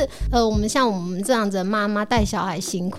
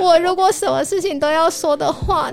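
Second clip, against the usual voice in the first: mock tearful plea, a bit louder; tearful girlfriend; 5 semitones higher, 3 dB louder than usual. Girlfriend complains to her boyfriend, mock tearful. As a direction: act out the hurt girlfriend, half-crying. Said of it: "然后女生就哭了，女生就说".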